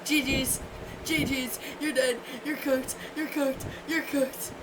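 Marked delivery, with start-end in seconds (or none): none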